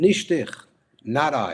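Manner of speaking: loud, high cry of denial, half acted; retold scream. Retold to listeners, the Rebbe screams his denial, a mild mock yell. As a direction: cry out that it was not him. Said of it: "started screaming".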